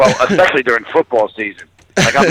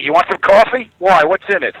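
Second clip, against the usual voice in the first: higher, mock-suspicious New Yorker; 8 semitones higher than usual. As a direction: grill the stranger like a paranoid New Yorker. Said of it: "It's like".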